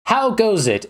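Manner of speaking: hammed-up adventurer hailing an orc; friendly adventurer. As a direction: greet a monster in pretend play. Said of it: "you walk up to the orc and you go".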